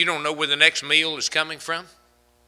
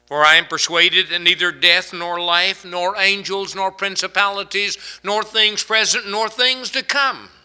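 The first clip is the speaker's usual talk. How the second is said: building scripture list, climbing steadily higher to the last item; preaching recitation; 5 semitones higher, 3 dB louder than usual. Real, not acted. Preacher reciting Paul's assurance to the congregation, building preaching cadence. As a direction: climb step by step through each "nor".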